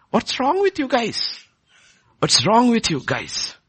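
imitating an upset, exasperated scolding; high-pitched reproach, easing slightly on the repeat; angry rebuke. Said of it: "He's angry and upset. He says".

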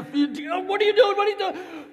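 very high, loud frantic screaming; hammed-up panicked shriek; acted panic. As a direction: scream it like a man in a sinking boat. Disciples panicking in the storm.

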